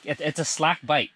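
normal talking voice

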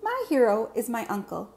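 ordinary talk

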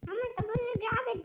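high, fast, frustrated question; exasperated. Real, not acted. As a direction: blurt it out in disbelief. Puzzling over a key, frustrated.

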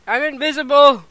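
high, loud announcement; excited exclamation. Real, not acted. Player discovering invisibility, exclaiming.

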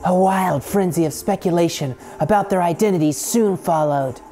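reading the story intro like a movie trailer, words trailing off; dramatic narration. Acted. Gamer reading the game intro, trailer-like. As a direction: read it like a trailer, grand. Reads intro text with echoes.

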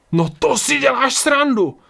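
very high, stretched-out cry; horrified shout. A narrator's reading. Ryan sees the priest with a boy, shouting in horror. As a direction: yell in appalled disbelief. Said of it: "vykřikl zděšený Ryan".

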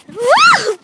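loud, high happy whoop; excited whoop. Real, not acted. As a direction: whoop with joy. Jumping on trampoline.

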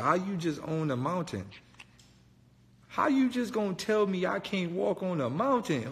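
normal talking voice

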